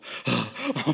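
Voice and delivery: loud imitated beast-like gasp; mock animal panting. Preacher mimicking church members running along the coast, animal-like gasping. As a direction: huff loudly like a running animal. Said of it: "짐승처럼 소리 내면서".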